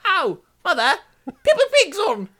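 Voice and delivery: put-on high, loud cockney kid calling mother; mock cockney child. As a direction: squeal it in a hammed-up cockney. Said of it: "cute little cockney".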